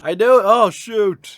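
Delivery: loud high shocked cry; shocked yell. Real, not acted. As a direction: react in sudden shock. Shocked at decapitation.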